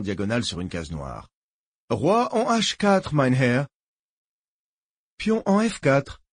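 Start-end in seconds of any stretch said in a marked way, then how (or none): 1.9-3.7 high, slow, deliberate call; announcing a chess move. Acted. Player addressing his opponent, announcing a move. 5.2-6.2 high, slow call of the move; announcing a chess move. Acted. Barent answering Willy's move, announcing his own.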